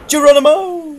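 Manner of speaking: loud, high whooping yell; high-pitched jumping holler; exuberant battle cry. Real, not acted. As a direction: yell it out as you leap. Calling out a jump in game.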